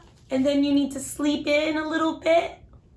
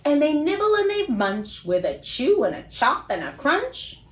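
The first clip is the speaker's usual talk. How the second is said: put-on lively, sing-song storybook read for little kids; reading to children; slower than usual. A narrator's reading. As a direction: read it like bedtime story time for small children. Reads picture book to kids.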